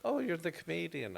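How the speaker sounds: put-on high, sweet old nana voice; little old British lady. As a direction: greet him like a polite little old lady. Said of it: "this little old lady comes up to me".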